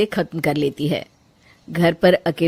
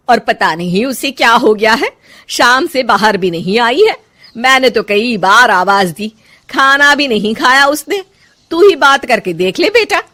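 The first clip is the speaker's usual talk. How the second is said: louder, higher complaining elder; worried mother-in-law; 3 semitones higher, 6 dB louder than usual. Acted. Mother-in-law complaining to son about daughter-in-law, loud and fretful. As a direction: pile on the grievances.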